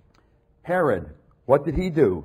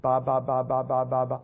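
level, run-together nonsense syllables; mock barbarian gibberish; mocking foreign babble. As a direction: imitate a foreign tongue as monotone babble. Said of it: "they speak like this".

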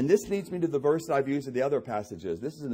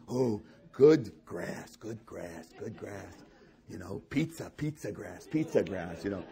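low, slow, hushed grazing sheep; contented sheep; 7 semitones lower, 4 dB quieter than usual. Acted. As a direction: murmur like a sheep savouring the grass.

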